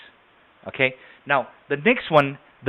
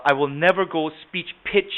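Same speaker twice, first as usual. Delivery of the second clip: normal talking voice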